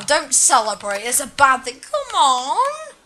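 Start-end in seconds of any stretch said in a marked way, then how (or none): 1.8-2.9 high-pitched, pleading frustration; exasperated plea. Real, not acted. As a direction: whine it up high at the game. Exasperated with the game.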